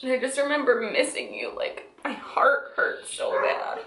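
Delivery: tearful near-whisper; crying, voice caught in throat; snotty crying. Real, not acted. Telling husband about letters.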